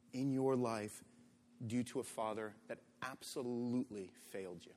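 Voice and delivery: hushed, reassuring pastor; tender pastoral voice. Acted. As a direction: speak softly to the hurting listener. Gentle pastoral address.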